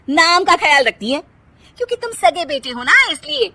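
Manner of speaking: mock the mother-in-law's love; scornful. Kirti scorns mother-in-law. (सास पर तंज़ करती पत्नी)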